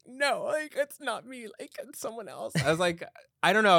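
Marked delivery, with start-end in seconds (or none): 0.1-2.5 very high-pitched, unconvincing denial; faking denial. Retold or imitated. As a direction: squeak out a badly faked cover-up, imitating your younger self. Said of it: "trying to fake it so hard".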